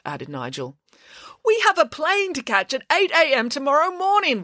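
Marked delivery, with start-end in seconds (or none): 1.4-4.4 high, mock wail of a panicked teacher. A panicked teacher wails about the early flight, high. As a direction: whine it like a frantic chaperone. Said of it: "wailed Mister McLean".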